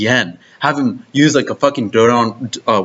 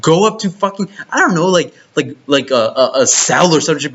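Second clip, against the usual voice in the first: higher-pitched, incredulous rant; heated rant; 7 semitones higher than usual. Real, not acted. Fan ranting at anime lovers, incredulous and heated. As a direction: rant in disbelief, pitch rising.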